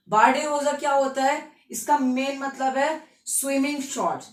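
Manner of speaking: usual speaking voice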